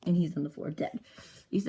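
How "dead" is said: ordinary delivery, nothing marked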